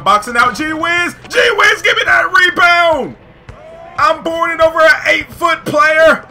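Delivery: high, loud triumphant play-by-play; hyped up. Real, not acted. Player rebounds over an eight-foot mascot, hyped yelling. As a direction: build to a full-voiced yell. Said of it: "I was so hyped when I was playing".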